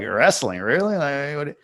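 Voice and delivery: incredulous question, pitch jumping up on "really"; mock disbelief. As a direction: imitate a doubtful neighbour reacting to news. Imitates dismissive reaction to wrestling.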